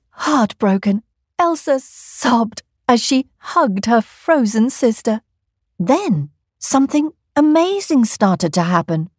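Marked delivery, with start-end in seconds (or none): none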